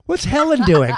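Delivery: high, loud mock-puzzled question; high-pitched put-on wondering about a friend; acted joke punchline. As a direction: call it out as a comic bit.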